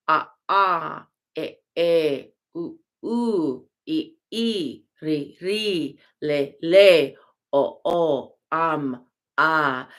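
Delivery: vowels said in pairs, a ah, oo oo; vowel recitation. Shown as a demonstration. Teacher sounding out the vowels for students. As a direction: sound out each vowel twice. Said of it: "these different sounds of the vowels".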